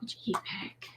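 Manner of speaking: whispering. Real, not acted. Partner whispers off mic.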